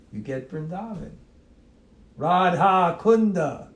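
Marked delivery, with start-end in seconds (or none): 2.2-3.7 high, loud, drawn-out RADHA KUNDA; emphatic recitation. Shown as a demonstration. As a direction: proclaim Radha Kunda slowly and loudly. Recites name, then repeats it differently.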